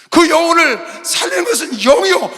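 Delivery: loud, slow, high shout; full-force sermon shout; preaching shout. Real, not acted. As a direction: drive the truth home to the church.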